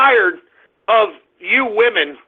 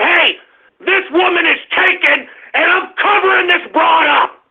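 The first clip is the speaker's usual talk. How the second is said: a hint of a possessive husband, higher and rising to a shout; mock husband; 7 semitones higher than usual. Ranting host voicing a husband who covers his wife, mock shout. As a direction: play the husband staking his claim, rising to a yell.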